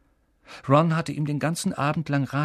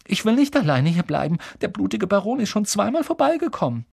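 high, pleading voice; frightened plea; 9 semitones higher, 4 dB louder than usual. A narrator's reading. Neville fears the Bloody Baron will return, frightened pleading. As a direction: plead urgently, voice pitched up.

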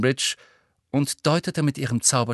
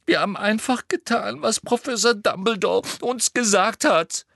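a distressed schoolgirl; Hermine voice; 11 semitones higher than usual. A narrator's reading. (Hermine mit hoher, verzweifelter Stimme)

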